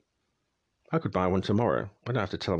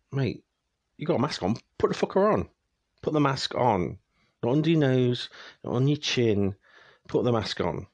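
exasperated rant; 4 semitones higher than usual. Real, not acted. Ranting at people with masks under their noses, angry. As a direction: scold them like a fed-up mate.

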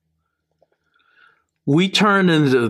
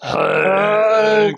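Hank in bigfoot; 3 semitones higher than usual. Pretend. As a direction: cry the name Hank like an ape. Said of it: "How do you say Hank and Bigfoot".

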